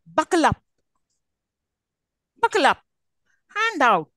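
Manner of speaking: "Buckle up", "Hand out" with UP and OUT stressed; stress on particle. Shown as a demonstration. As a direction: lean on UP and OUT. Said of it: "off should be stressed".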